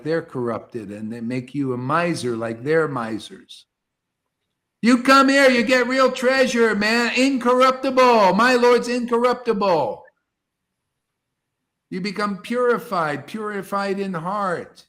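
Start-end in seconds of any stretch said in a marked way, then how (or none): 4.8-10.0 louder, higher, preaching at full force; fervent preaching. Acted. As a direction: proclaim it like a revival preacher. Preaching climax to listeners.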